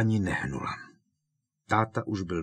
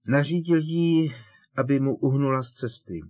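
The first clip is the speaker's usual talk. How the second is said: higher, louder, pressing hard; relentless; 6 semitones higher, 6 dB louder than usual. Acted. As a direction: drive the point home without mercy. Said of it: "pokračoval nemilosrdně Harry". (nemilosrdně)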